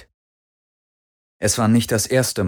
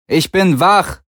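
high, loud angry yell; shout; 10 semitones higher, 5 dB louder, slower than usual. A narrator's reading. Galen yelling to his captors that he is awake, angry shout. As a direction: bellow it so they hear. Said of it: "brüllte er".

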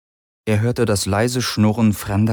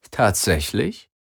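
slow, lightly surprised question; a drawn-out, softly surprised query; mild surprise; 3 dB quieter, slower than usual. A narrator's reading. Captor Heska learning Galen didn't know, mild surprise. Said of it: "blickten überrascht".

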